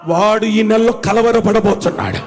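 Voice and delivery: ordinary talk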